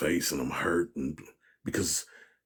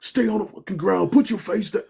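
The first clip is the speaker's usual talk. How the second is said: retold shouting; 9 semitones higher, 10 dB louder than usual. Retold cop standoff shouting.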